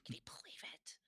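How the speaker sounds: hushed unvoiced aside; whispering. Real, not acted. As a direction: whisper it as a secret. Unvoiced whispered aside about a cousin.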